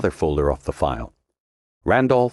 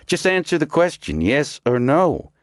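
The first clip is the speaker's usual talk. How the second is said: impatient demand; 11 semitones higher than usual. A narrator's reading. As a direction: hammer each word with impatience. Commando loses patience.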